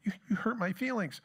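high, whiny oversensitive voice; mock hurt whine. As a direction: whine like someone easily offended. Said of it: "we have become so incredibly sensitive".

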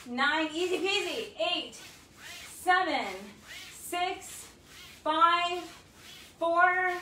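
energetic workout countdown; coach counting reps. Acted. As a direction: call the count out like a fitness coach.

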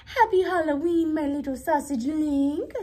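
excited storybook mother voice; doting mother. A narrator's reading. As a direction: gush a big holiday greeting to your little one. Mother greets Oscar in story.

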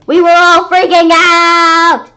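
slow, loud, high panicked cry; freaking out. A narrator's reading. Narrator describing everyone panicking, loud and high. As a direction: wail it in panic.

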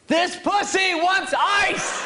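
betray him loudly to the whole room; sudden shout. Retold or imitated. Brother turns and yells to the frat brothers. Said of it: "And he goes".

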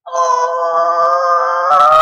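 long, loud scream; shocked scream. Real, not acted. Gamer failing suddenly in a game, shocked scream.

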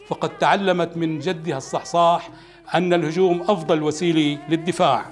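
usual speaking voice

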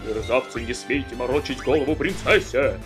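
loud furious scolding; angry shout. Acted. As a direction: bellow at them in rage. Guard angrily confronts kidnappers.

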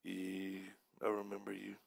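low, soft, sheepish reply; imitating a downcast young man; subdued kid. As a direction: mumble it low, as if looking down. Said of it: "he was looking down and he goes".

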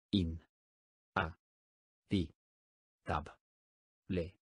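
"In. A. Ti. Tab. Le." each syllable spoken apart with a pause. Shown as a demonstration. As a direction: split "Inhabitable" into separate syllables. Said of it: "dila silábicamente conmigo". (separando sílabas: "In. A. Ti. Tab. Le.")

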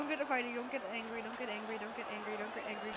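low, soft repeated mantra; hushed, low chant to self; quiet self-calming. Real, not acted. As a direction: talk yourself down under your voice. Calming self during game.

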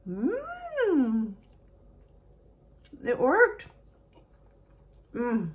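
high, loud happy mmm; thrilled it actually worked; delighted surprise. Real, not acted. Egg turns out right.

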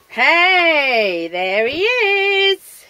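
loud, high, long-drawn cheer; excited welcome. Real, not acted. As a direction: hail the returning hero with a big stretched whoop. Hailing pet's return.

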